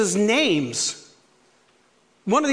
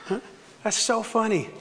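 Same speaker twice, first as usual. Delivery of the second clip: ordinary delivery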